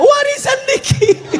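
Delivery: high, drawn-out comic wail; mock wailing. Widow telling neighbour the inheritance is small, mock wailing. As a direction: keep up the exaggerated high sob.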